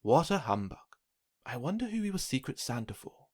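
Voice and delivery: plain reading voice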